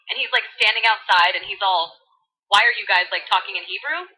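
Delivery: put-on bubbly valley girl voice. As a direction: read scripture like a valley girl. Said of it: "Valley Girl edition".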